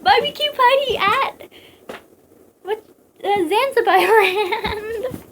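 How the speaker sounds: high, slow laughing mockery. Real, not acted. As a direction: cackle through the joke.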